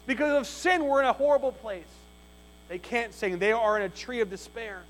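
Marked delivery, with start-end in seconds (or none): 0.0-1.9 loud, high, pressing preacher's cry; preaching at full force. Acted. Preacher to his congregation, full-force preaching. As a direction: preach it out with rising fervour.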